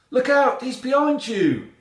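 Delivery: very high, loud alarm; warning shout. A narrator's reading. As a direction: shout an urgent warning as the cat. Cat warns the heroine in story.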